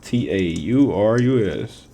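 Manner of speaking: slow letter-by-letter spelling; careful dictation of letters. Shown as a demonstration. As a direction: spell it out slowly as you write. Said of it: "write that down for Taurus".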